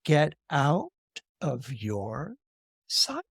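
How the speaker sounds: quiet, halting menace, rising at the end; softly threatening goddess, word by word; ominous tone. Acted. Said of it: "from that tone it's not good".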